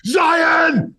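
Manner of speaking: sudden high shout of a name; angry dad shouting at his son; yelling his name. Retold or imitated. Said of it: "And I'm yelling".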